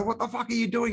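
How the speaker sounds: high exasperated shout at a bad driver; furious driver yelling at the car in front; mock road-rage yell. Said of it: "you beep at them and go".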